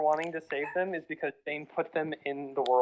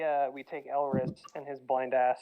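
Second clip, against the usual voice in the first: ordinary delivery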